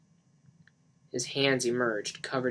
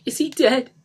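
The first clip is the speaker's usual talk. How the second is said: high, loud, choking on the words; choked out; 13 semitones higher, 10 dB louder than usual. A narrator's reading. Lisa to Mark over the body, choking. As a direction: force the question out through tears. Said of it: "she choked out".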